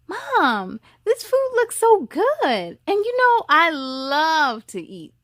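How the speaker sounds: put-on high, slow gushing little girl; child delighted by her mom's breakfast, squealing; excited child. Little girl thanking her mom, high and gushing.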